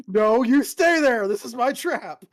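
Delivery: playful loud taunt. Acted. Player teasing, loud.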